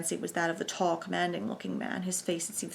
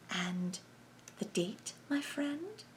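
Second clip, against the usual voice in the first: soft sibilant voice; 7 semitones higher, 6 dB quieter, much slower than usual. A narrator's reading. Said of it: "said number one".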